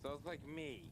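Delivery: ordinary talk, nothing marked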